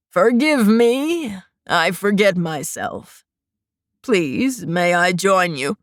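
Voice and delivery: put-on low, slow creepy old man voice; an old man sneering sweetly, low and slow; wheezy old man. A narrator's reading. Creepy old grandfather teases the hiding girl, low and slow.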